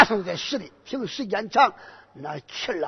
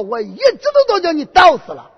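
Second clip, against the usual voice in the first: shrill boast; 9 semitones higher, 7 dB louder than usual. Acted. Boy hero boasting back.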